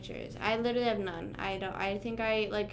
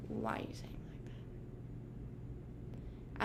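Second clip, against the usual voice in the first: whisper; 13 semitones lower, 17 dB quieter, faster than usual. Acted. Repeating question, dropped to whisper.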